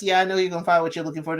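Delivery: rattled off fast and snippy; really fast. Retold or imitated. Dumped man brushing off Hannah, rattled off fast and snippy. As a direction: rush it out curtly, like you want it over. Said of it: "he says it, like, really fast".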